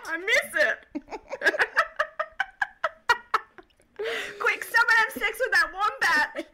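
high, giggling through the words; laughing. Real, not acted. Joking with co-host about covid, laughing hard.

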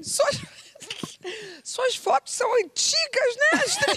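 voz bem mais aguda e lenta, imitando a colega jovem; imitando a menina moderna. Retold or imitated. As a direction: imite a assistente debochando das fotos antigas, agudo. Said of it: "vira pra mim e fala assim". (menina moderna imitada em falsete)

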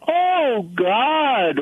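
mock cry to heaven, pitched high; drawn-out, high imitation of anguish. Caller imitates people crying out to God, anguished. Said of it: "we cry out in anguish".

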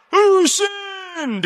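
very high yelped question; mock squeal. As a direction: squeak it out while tumbling. Mock disciple falling down steps.

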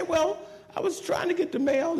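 high-pitched, protesting excuse; defensive excuse. Retold or imitated. Spouse explaining about the mail, high defensive protest. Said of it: "you try to say".

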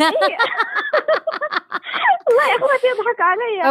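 high giggly voice; laughing. Real, not acted. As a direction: laugh through the words. Caller laughing embarrassed.

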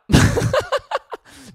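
big high falling laugh. Real, not acted. Comic cracking up at his own pun with a high laugh. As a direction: cackle up high then let it fall.

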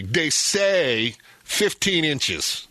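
slow, doubtful they say fifteen inches; incredulous. Retold or imitated. Dad mocking the weatherman's snow forecast, slow and incredulous. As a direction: drag it out, not believing a word. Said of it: "he always says it incredulously".

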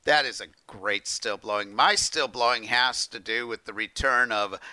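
ordinary delivery

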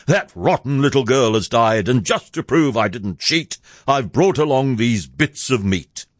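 plain reading voice

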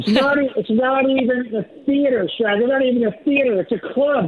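heckling puppet. Acted. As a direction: heckle your ventriloquist as his puppet.

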